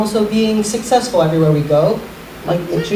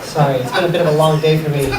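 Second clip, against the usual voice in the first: ordinary delivery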